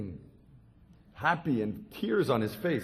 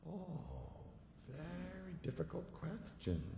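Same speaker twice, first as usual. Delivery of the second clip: softer, slow and solemn; soft weighty reply; 5 semitones higher, 17 dB quieter, slower than usual. Acted. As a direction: let it sink in gravely. Boy voicing a weighty reply.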